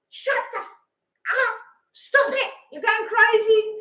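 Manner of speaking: high, hammed-up imitation of the husband snapping; mock the husband telling her off. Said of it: "and Michael went".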